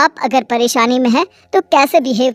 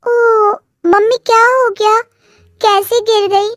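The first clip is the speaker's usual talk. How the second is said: higher voice, mock concern; daughter; 7 semitones higher than usual. A narrator's reading. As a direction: fake worried daughter. Daughter voiced, high far. (बनावटी चिंता वाली बेटी)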